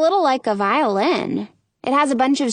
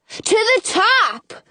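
shouting; 11 semitones higher, much slower than usual. A narrator's reading. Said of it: "they both shout back".

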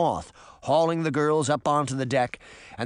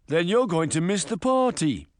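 put-on higher English-accented pirate; pirate henchman; 4 semitones higher than usual. A narrator's reading. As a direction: taunt a captive as a pirate henchman. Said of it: "the other pirate said".

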